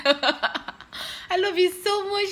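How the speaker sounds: slow, laughing through the words; laughing thanks. Real, not acted. Thanking Dima for the giveaway prize, laughing. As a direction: giggle out a grateful I love you.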